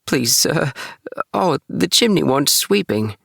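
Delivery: high, pleading kitten voice; poor Tom Kitten. Acted. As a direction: beg timidly, like a frightened kitten. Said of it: "said poor Tom Kitten".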